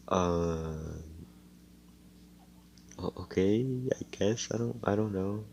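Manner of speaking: soft, unsure mumble; hesitant and quiet. Acted. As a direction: trail off, unsure of yourself. Noelle hesitantly agreeing.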